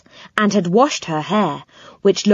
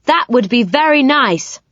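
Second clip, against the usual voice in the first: loud voice; 6 semitones higher than usual. Acted. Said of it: "said Joe in a loud voice".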